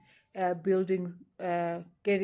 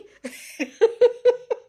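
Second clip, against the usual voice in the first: a much higher, louder burst of laughter; nervous laugh; 11 dB louder than usual. Real, not acted. Admitting to viewers that it eases her panic, nervous laugh. Nervous relief while talking.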